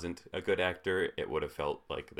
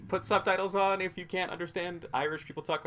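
mock advice; 13 semitones higher than usual.

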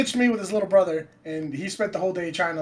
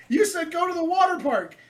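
put-on innocent kid protest; 12 semitones higher than usual.

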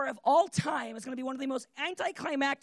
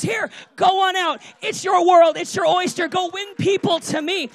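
loud, raised-pitch urging; rousing send-off; 5 semitones higher, 11 dB louder than usual. Retold or imitated. Jesus sending his church out to win people, rousing send-off. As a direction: shout them out into the world.

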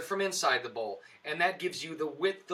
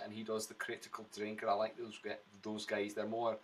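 lower, softer Scottish brogue; broguish Scottish accent; 6 semitones lower, 6 dB quieter than usual. Shown as a demonstration. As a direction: keep up a quiet Scots accent as an aside. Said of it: "Mine's more broguish".

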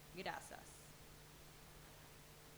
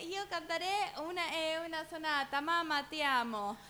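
put-on little boy voice; 6 semitones higher, 5 dB louder than usual. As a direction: play the little son announcing his sonata. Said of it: "y son varios". (voz aguda de nene chiquito, muy lenta)

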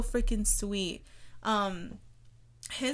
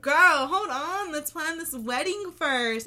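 sassy; 6 semitones higher, 7 dB louder, slower than usual. Retold or imitated. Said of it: "It's like".

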